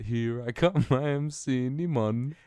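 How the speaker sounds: slow, laughing cartoon voice; cinnamon character voice. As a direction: copy the character, slow and amused. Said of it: "the cinnamon from Applejack".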